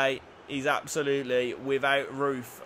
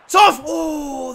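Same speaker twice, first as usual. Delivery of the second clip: high, loud burst; thrilled fan outburst; excited shout; 10 semitones higher, 11 dB louder than usual. Real, not acted. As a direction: yell at the shot. Fan reacting to a shot.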